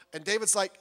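ordinary delivery

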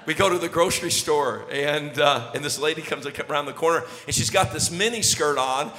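usual speaking voice

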